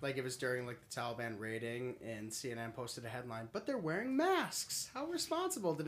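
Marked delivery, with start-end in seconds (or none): 3.5-5.7 drip with sarcasm; sarcastic. Retold or imitated. Mocking a CNN headline.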